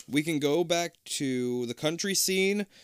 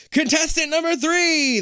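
high, loud showman announcement; announcer declaring; 8 dB louder than usual. Acted. Host announcing the pageant winner like a showman. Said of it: "the voice declares".

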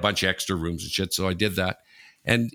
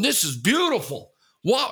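much higher, awed roommate; raving; 10 semitones higher than usual. Retold or imitated. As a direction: marvel at the room, wide-eyed. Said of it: "this guy came in raving".

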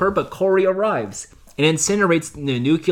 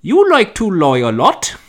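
Cockney slip; 4 dB louder, slower than usual. Retold or imitated. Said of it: "a little British Cockney going on".

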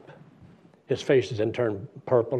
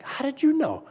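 high-pitched startled question; startled surprise; 13 semitones higher, 3 dB louder than usual. Retold or imitated. Man hearing his friend's name known, startled surprise. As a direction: ask it amazed, voice jumping up.

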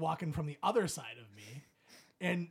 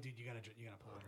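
much softer, sickly, barely getting the words out; weak queasy plea; 6 semitones lower, 14 dB quieter, much faster than usual. Retold or imitated. Telling his buddy to pull over, soft and queasy. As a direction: sound like you're about to throw up. Feeling sick, about to throw up.

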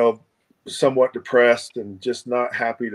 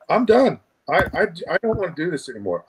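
exasperated outburst; 6 semitones higher, faster than usual. Retold or imitated. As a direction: burst out with it, at the end of his rope. Retold giving up on the trail.

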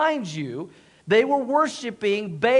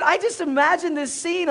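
ordinary delivery

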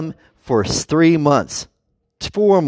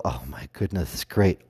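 hushed sigh; 10 semitones lower, 9 dB quieter than usual. Retold or imitated. Bedroom joke.

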